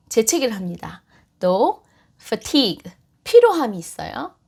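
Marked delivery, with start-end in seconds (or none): none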